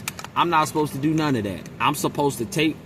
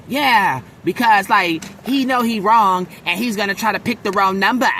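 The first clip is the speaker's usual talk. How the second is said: mock Caucasian accent; 5 semitones higher, 4 dB louder than usual. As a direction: exaggerate her Caucasian accent, pitched up. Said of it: "a permanent voice is typically Caucasian".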